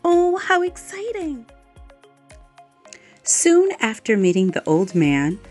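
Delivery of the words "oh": plain reading voice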